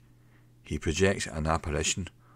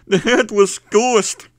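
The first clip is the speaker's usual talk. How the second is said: high, loud frantic terror; panicked shriek; 11 dB louder, much slower than usual. A narrator's reading. Barman screaming to his boss, high frantic terror. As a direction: screech it out in blind panic.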